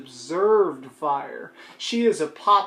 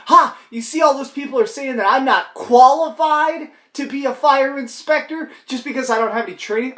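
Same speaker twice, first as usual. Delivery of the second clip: mock gloating; 8 semitones higher, 7 dB louder than usual. Mock gloating retort voiced for her.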